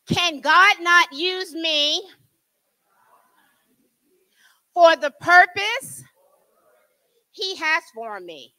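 slow and drawn out, leading the room; slow call to repeat. Shown as a demonstration. Preacher leading the church in a slow repeat-after-me. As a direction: lay it out slowly so they can follow. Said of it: "Repeat after me".

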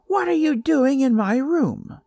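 put-on high, loud, nasal bully girl; shrill nasal yell. A narrator's reading. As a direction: yell it shrill and through the nose. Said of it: "she yelled with a shrill and nasal voice".

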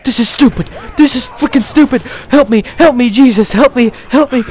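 screaming for help while chased; real panic, loud and high; panicked yelling. Real, not acted. Being chased, screaming in game.

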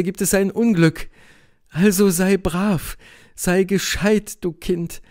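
high woman's voice, slowing to coaxing; explain, then coax him like a child; resigned Helene. A narrator's reading. (resignierte Helene, zuletzt langsam beschwichtigend)

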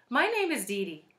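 a hint of a small, energetic child. A narrator's reading. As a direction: pipe up a little. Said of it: "Her voice was small but full of energy".

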